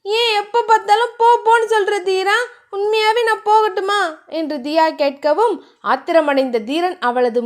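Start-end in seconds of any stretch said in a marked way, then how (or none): 0.1-4.0 put-on higher, wounded girl's voice; hurt young woman. A narrator's reading. Dhiya asks Dheeran if she should really leave, higher and hurt. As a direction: ask it hurt and challenging. Hurt girl challenges him.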